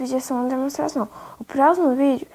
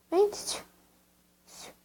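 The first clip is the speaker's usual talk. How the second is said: higher clicking call to a pet; 8 semitones higher, 7 dB quieter than usual. Real, not acted. Luring the animal with food, higher pet call. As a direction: call the animal over, pitched up. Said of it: "eu sou acostumada a falar assim com ele".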